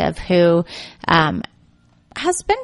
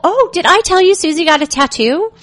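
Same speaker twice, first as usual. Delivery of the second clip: fake and pivot; 11 semitones higher, 4 dB louder than usual. Shown as a demonstration. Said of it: "you just do a fake and pivot".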